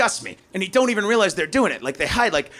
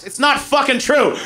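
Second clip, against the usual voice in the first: ordinary talk, nothing marked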